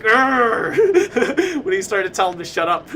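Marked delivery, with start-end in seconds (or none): 0.0-1.6 loud, high growl of exasperation; mock angry growl. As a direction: growl as if pissed off, hammed-up. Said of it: "like, pissed off and be like".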